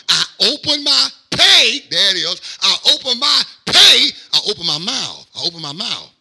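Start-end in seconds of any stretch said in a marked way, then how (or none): 0.1-4.1 high, loud proclaiming of the verse; loud declaration. Acted. Preacher proclaiming the psalm verse to the church, loud. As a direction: ring the verse out over the congregation.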